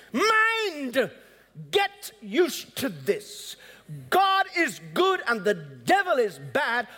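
high, sudden call to the mind, then slow; commanding his mind. Acted. Preacher tells his own mind to obey, commanding. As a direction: order your own mind to listen.

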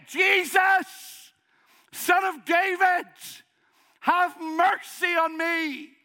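high, loud, slow desperate cry. Retold or imitated. Blind Bartimaeus crying out to Jesus by the roadside, loud and high. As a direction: call out to Jesus over the crowd. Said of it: "And he is crying it".

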